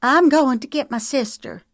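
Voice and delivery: high, loud and scared; frightened. A narrator's reading. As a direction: threaten through fear. Sarah frightened, backing away.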